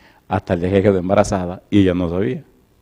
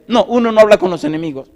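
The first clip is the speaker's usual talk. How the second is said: put-on jealous child; 6 dB louder than usual. Voices sulky jealous daughter.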